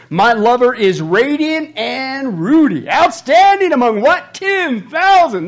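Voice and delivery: loud, high, slow declamation; over-the-top lovestruck recitation; impassioned scripture reading. Acted. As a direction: proclaim the love poem with huge drawn-out emphasis. Acts out the woman's love poem.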